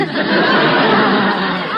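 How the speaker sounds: loud laugh at the silly name; loud belly laugh. Acted. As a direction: burst out laughing.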